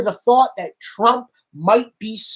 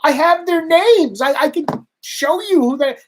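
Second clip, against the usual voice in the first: insistent retort; 8 semitones higher than usual. Retold or imitated. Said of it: "I said".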